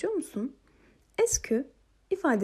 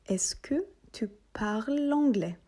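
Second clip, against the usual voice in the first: slow model question. Shown as a demonstration. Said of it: "Haydi tekrar edip".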